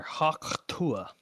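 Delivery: ordinary delivery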